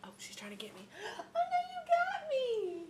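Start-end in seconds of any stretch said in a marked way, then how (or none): 0.9-2.8 put-on very high squeal for a baby; squeaky pretend panic, playing along; playful mock distress. Playing with baby trying to get her.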